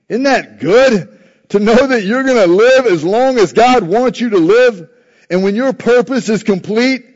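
high, loud, impassioned sermon delivery; full-force preaching. Acted. Preacher rallying the congregation, loud impassioned preaching. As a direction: preach it out to the back row.

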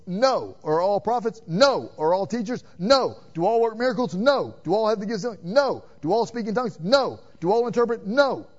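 each No punched hard and loud; emphatic no. Shown as a demonstration. Preacher answering each question with firm No. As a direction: slam down every answer. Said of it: "demands an emphatic no".

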